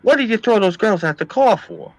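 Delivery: high-pitched cousin going nuts; imitated frantic protest. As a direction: protest up high, mock outrage. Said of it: "he's going all nuts".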